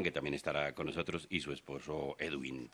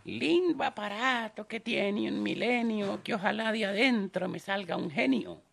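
put-on high slow old lady voice; 7 dB louder, slower than usual. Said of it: "Y la señora".

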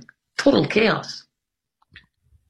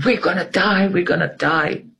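higher, retold scream of panic; mock panic; 7 semitones higher than usual. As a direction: mimic the crowd's panic, higher. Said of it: "Everybody is screaming".

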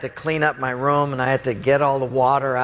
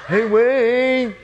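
high, drawn-out call through a door; pestering friend calling, stretched name; 8 semitones higher, 4 dB louder than usual. Retold or imitated. Friend at the bedroom door at night, high drawn-out calling. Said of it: "then I hear this voice".

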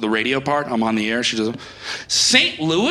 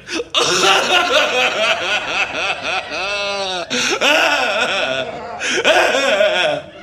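long laugh breaking into crying; laugh turning to sobs; 4 semitones lower than usual. Acted. DJ going live just after the breakup, laugh-crying. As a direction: let the laugh crack into a sob.

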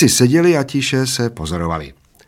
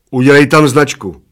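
loud, raised order; commanding; 5 semitones higher, 8 dB louder than usual. A narrator's reading. Bald man orders the little one, commanding. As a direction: order it firmly and loudly. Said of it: "poručil Plešatec".

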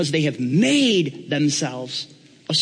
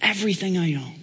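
eager pitch climbing ever higher; fired-up Donald Trump; 9 semitones higher than usual. Acted. Apprentice offering Paul everything he owns, fired up. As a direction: get carried away with your own grand offer. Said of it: "I guess you're pretty fired up".

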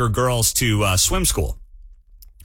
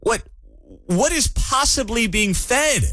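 incredulous mockery; 7 semitones higher than usual. Real, not acted. Host mocks lavish spending.

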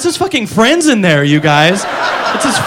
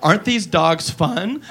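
put-on slow, soft little girl voice; soft slow cutesy read; 5 semitones lower, 7 dB quieter, slower than usual. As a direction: coo it like a kid in an ad. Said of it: "now she goes".

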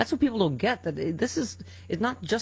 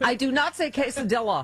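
higher-pitched mock protest; mock indignation; 6 semitones higher than usual. Real, not acted. Host denying the co-host's teasing, mock indignant. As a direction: deny it with playful indignation.

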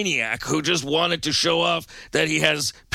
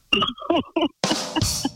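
big laugh; 11 semitones higher than usual. Real, not acted. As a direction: burst out laughing. Host laughs at own joke.

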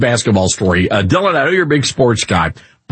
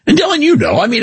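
ordinary talk

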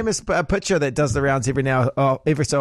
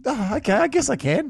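reluctant agreement; 9 semitones higher than usual. Acted. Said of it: "I'd be like".

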